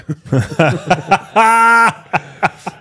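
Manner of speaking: laughing hard as dad cuts him down; big real laugh. Real, not acted. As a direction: break into a hearty laugh. Laughs at dad's put-down.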